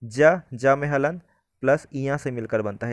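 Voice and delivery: slow, deliberate teacher breakdown of ज; slow explanation. Shown as a demonstration. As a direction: spell out ज में हलन step by step. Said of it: "और ज्ञ जो है".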